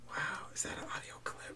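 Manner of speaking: fast whisper. Pretend. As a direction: whisper it quickly. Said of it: "joke voice".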